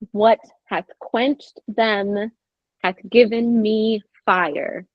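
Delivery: very slow, every stress leaned on heavily; verse rhythm hit hard. Shown as a demonstration. Actor reads the opening lines for the director, slow and stressed. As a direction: beat out each iambic stress deliberately. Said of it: "hit the rhythm harder than they will".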